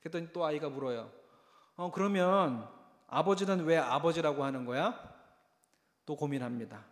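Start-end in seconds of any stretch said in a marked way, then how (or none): 1.8-5.1 높고 크게 묻는 아이 목소리; 궁금해 죽겠는 꼬마처럼. Retold or imitated. 아이 목소리로 묻는 장면. (높고 큰 아이 흉내 질문)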